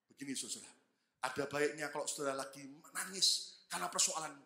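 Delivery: ordinary talk, nothing marked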